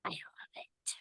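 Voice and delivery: very soft whisper; whispered aside. Real, not acted. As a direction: whisper it as a delighted confession. Aside after creepy book description.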